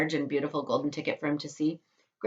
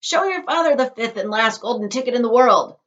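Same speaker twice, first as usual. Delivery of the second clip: louder, higher urging; eager shout; 7 semitones higher, 9 dB louder than usual. Acted. Grandpa Joe urges Charlie to show his father, loud. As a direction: push Charlie forward.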